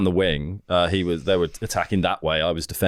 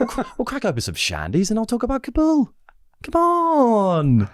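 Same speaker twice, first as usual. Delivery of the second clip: sarcastic mock. Sarcastic mock party invite.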